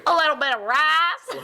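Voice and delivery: laughing imitation of an Alabama twang; mock Alabama accent. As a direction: tease with a southern accent, amused. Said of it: "splattering of Alabama in there".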